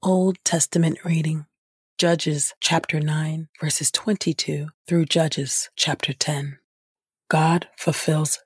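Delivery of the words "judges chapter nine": ordinary talk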